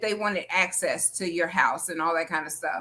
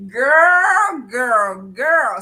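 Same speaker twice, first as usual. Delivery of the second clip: loud, high, drawn-out exclamation; real astonishment; 4 semitones higher, 9 dB louder, much slower than usual. Real, not acted. Host hears of the outburst at Mother's Day, astonished. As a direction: draw the word out high and loud, stunned. Stunned reaction to the mother-in-law story.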